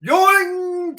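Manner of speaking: a very high, loud cartoon "Yoing!"; comic yoing. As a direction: fire off a cartoon boing sound. Mimics reaction to hanging up.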